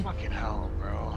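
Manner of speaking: whispering. Real, not acted. Hiding from monster.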